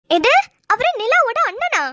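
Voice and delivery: high, drawn-out incredulous question; stunned disbelief. Acted. Friend learning he is Nila's brother, stunned.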